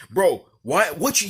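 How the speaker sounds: high, loud mock-confrontational start; angry. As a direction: imitate a mad guy sputtering a demand. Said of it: "he was angry, he was mad".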